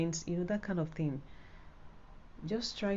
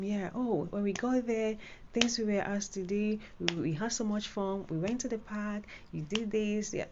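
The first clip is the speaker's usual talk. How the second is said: higher, upbeat and smiling; excited; 5 semitones higher than usual. Shown as a demonstration. Candidate telling the examiner about a fun trip, excited. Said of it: "They can even be smiling with you".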